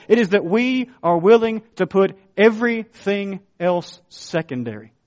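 full-force preaching with punched repeats. Acted. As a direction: hammer each claim home.

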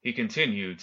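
ordinary delivery

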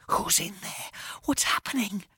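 hushed, anxious whisper; frightened whisper. Acted. Boy whispering to Jake about the noises, frightened whisper. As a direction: whisper urgently, scared of being heard. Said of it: "I whispered".

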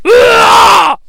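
loud, high imitated roar; hammed-up crazed holler; madman scream. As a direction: yell like a leaping madman.

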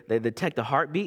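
ordinary talk, nothing marked

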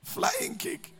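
squeaky-high repeat of the punchline; high-pitched echo. Retold or imitated. Preacher repeating the joke after laughing, high-pitched echo. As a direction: echo it far up high, without shouting.

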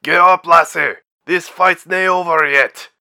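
loud, high rallying shout; called out. A narrator's reading. Bauer rallies the fallen pilot before charging, loud call. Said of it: "Bauer called out".

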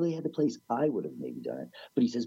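normal talking voice

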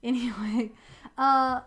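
ordinary talk, nothing marked

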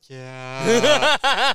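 a hammed-up sob of a yes; mock sobbing yeah. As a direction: imitate yourself breaking down crying. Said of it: "And I just lost it".

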